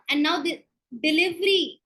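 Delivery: ordinary delivery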